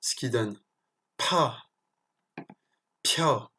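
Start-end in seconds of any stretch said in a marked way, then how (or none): 1.2-1.6 PA with a strong puff of air after the P; aspirated "pa", air released before the vowel. Shown as a demonstration. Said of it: "mais expiré". 3.0-3.5 PIA with a strong puff after the P; "pia" with an emphatic aspiration; aspirated P. Shown as a demonstration. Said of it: "il faut vraiment insister sur l'expiration".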